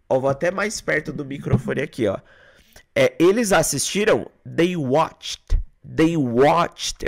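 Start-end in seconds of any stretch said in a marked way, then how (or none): 4.5-7.0 "watched" as one syllable ending in "t"; correct "ed" pronunciation. Shown as a demonstration. Teacher modelling the right past tense, "watched" as "wotcht".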